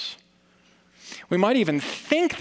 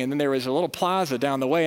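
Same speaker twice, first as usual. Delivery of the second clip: ordinary talk, nothing marked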